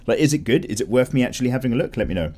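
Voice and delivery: ordinary delivery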